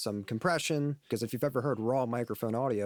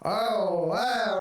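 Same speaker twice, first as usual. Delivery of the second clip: chorus-like phased voice; warbling phaser on the voice; detuned effect; 8 semitones higher, 5 dB louder than usual. Shown as a demonstration. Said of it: "the fifth one sounds really fucked up".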